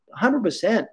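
ordinary talk

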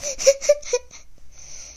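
a high little giggle; a high, delighted storyteller's giggle. A narrator's reading. Narrator laughs after the trot.

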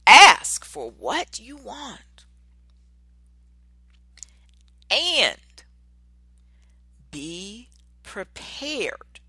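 very slow, loud and high, then settling; slow, ringing declaration; proclaimed quote. Acted. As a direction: proclaim it like a motto. Repeating the topic loudly.

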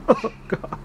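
ordinary delivery

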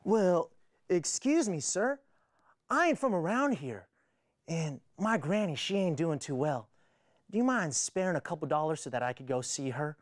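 lightly voiced Southern cowboy; cowboy drawl. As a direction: ask politely with a country twang. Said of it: "this cowboy comes up to me".

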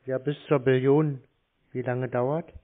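usual speaking voice

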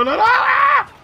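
high panicked yell; panicked scream. Real, not acted. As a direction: scream as you get blown up. Getting killed in game.

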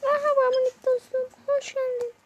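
very high, squeaky voice for a tiny friend; greeting a tiny pet in a squeaky baby voice; cute baby voice. Acted. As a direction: coo a welcome at a little creature.

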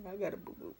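laughing through the line; retold whine, laughing; laughing retelling. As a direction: giggle as you say it. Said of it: "Ooh, excuse me".

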